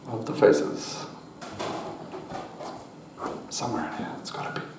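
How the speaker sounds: hushed, searching through cards; whispering. Real, not acted. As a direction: whisper it to yourself. Searching the deck quietly.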